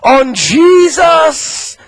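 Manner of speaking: loud, high whooping preacher climax; full-force preaching. Acted. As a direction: build the sermon to a ringing shout.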